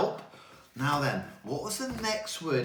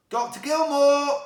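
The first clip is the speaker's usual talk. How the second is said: high, loud call of the name; 13 semitones higher, 10 dB louder than usual. Real, not acted. Teacher calling out to the doctor. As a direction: call out for him.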